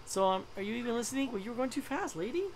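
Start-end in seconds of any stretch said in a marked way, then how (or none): none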